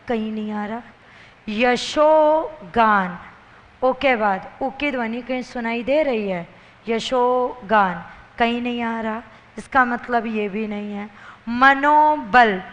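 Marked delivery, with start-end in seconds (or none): 1.5-3.2 यशोगान very slow, each piece held; syllable by syllable. Shown as a demonstration. Teacher sounding out यशोगान for students, very slowly. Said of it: "बोल के देखो". 11.5-12.6 मनोबल very slow and louder; syllable by syllable. Shown as a demonstration. Teacher sounding out मनोबल for the class, slow and loud. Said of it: "बोल के देखिए".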